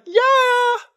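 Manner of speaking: big high whoop of victory; a triumphant high shout; triumphant yell. Retold or imitated. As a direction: cheer like you just won. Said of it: "Peter would be like".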